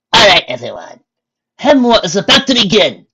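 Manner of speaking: loud call to the crowd. Acted. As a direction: call everyone to order. Freya calls the room.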